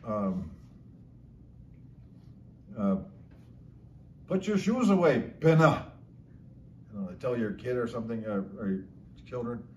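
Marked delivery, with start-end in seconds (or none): 4.3-5.9 raised pitch, loud last word; emphatic command. Shown as a demonstration. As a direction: order a child, landing hard on the final word. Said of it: "Pinna gives ec-, emphasis to the command".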